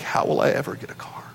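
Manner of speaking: hushed, quick, half-whispered worrying; retold night-time worry. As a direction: imitate anxious whispering to oneself at night. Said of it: "at night laying awake stressing about".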